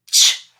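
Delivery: crisp "Ch" sound alone; isolated ch sound. Shown as a demonstration. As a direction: say "Ch" sharply on its own. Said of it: "When I put my hand like this, I want the sound".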